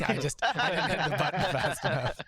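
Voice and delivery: real laughter, loud and quick; laughing. Real, not acted. GM laughs at missing the button. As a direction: laugh through the excuse.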